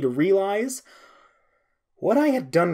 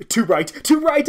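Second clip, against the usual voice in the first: loud, rising higher on the repeat; yelling; 9 dB louder than usual. A narrator's reading. Narrator blinded by light, yelling in pain. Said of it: "I yelled".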